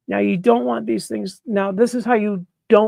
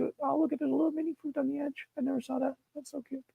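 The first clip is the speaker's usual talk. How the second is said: higher and much softer; delighted surprise; 6 semitones higher, 10 dB quieter, faster than usual. Real, not acted. Spotting a mini mushroom, soft cooing surprise. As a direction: coo over a tiny discovery. Finding tiny mini fruit.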